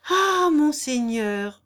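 high, loud, drawn-out exclamation; feigned surprise. A narrator's reading. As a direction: pretend to have only just noticed him. Wife feigns noticing the lord. (surprise feinte, voix haute et traînante)

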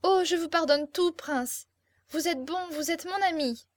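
acted joyful relief; overjoyed gratitude. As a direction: gush forgiveness to a benefactor. Overjoyed captive thanks prince.

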